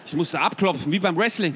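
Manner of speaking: ordinary delivery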